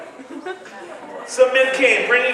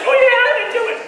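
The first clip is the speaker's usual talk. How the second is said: high, loud mock innocence; cheeky lie; 6 dB louder, faster than usual. Larry denying to Jesus, high and loud mock-innocent. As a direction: play the fibbing rascal.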